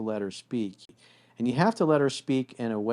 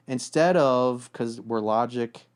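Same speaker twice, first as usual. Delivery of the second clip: normal talking voice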